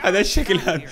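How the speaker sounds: high, loud laugh through the words. Real, not acted. Gamer laughing at a character's strange looks. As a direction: crack up at the odd face.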